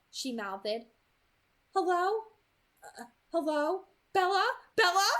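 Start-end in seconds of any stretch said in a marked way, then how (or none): 1.7-5.1 loud, high, urgent calling; mother's panic. A narrator's reading. Frightened mother calls Bella on the phone, panicked. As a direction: call out anxiously for your child. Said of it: "it was the sound of panic".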